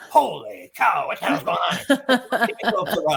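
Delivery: slow, drawn-out mock astonishment; hammed-up awestruck crowd reaction; excited amazement. Mimicking what they want people to say, amazed and excited. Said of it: "people are getting all excited".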